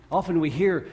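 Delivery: ordinary delivery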